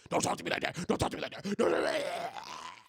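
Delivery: hammed-up parent scold; mock strict parent. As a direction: play a petty parent snapping back. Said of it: "punk little parents".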